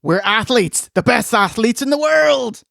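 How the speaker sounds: lightly mocking proclamation; imitated sergeant's answer. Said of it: "He's got an answer".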